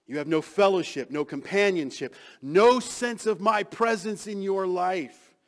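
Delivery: loud, building, slowing on the last line; urgent warning. Acted. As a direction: warn him loudly, slowing at the end. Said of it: "with a warning".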